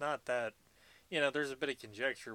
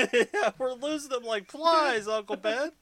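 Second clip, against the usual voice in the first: laughing mock line; 13 semitones higher, 11 dB louder than usual. Joking movie line through laughter.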